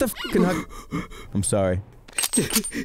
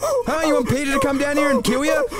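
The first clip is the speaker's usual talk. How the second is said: angry yelling; 13 semitones higher than usual. Acted. Boss yelling at the monkey worker, furious. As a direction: scream at a useless worker.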